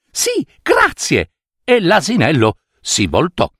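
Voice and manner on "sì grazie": very high, slow, sugary thanks; girl says yes, high and drawn out; sweet girl. Acted. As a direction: thank him slowly in a high little voice.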